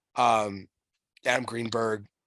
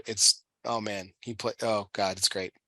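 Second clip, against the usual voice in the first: ordinary talk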